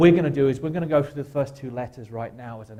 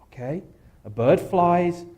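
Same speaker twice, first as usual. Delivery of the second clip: normal talking voice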